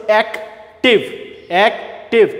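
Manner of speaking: "Active" broken in two with a pause; split into syllables. Shown as a demonstration. Teacher showing students the parts of "active", split into syllables. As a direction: say "active" as two separate beats. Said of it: "part part করে".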